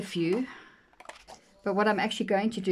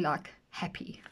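whispered aside; 3 semitones lower, 6 dB quieter, slower than usual. Real, not acted. Soft aside while browsing.

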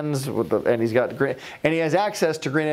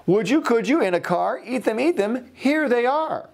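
high eager Sam; 9 semitones higher than usual. Acted. Sam pushes eggs from car.

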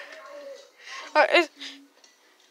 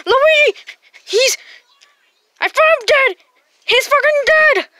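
high, loud shocked cry; high alarmed shout; panicked outcry; 9 semitones higher, 3 dB louder than usual. Pretend. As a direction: wail the news in panic. Finds toy friend dead.

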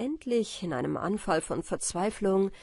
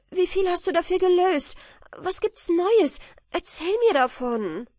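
higher, louder, rushing questions; eager wife; 7 semitones higher, 7 dB louder than usual. A narrator's reading. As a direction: pepper him with questions. Frau bestürmt Michael mit Fragen. (aufgeregte Ehefrau, lauter und höher)

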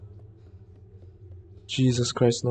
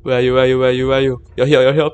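frantic; 2 semitones higher, 9 dB louder, much faster than usual. Real, not acted. Monster approaching.

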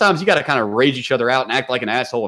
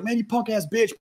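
high, softer mock insult; retold trash talk; 9 semitones higher, 5 dB quieter than usual. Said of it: "I'm still talking shit".

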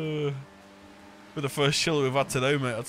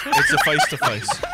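high mocking squeal; 5 dB louder than usual. Mocking a teammate's map pick, high squeal. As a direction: pitch jumps way up in a mocking squeal on "It's a face-to-face".

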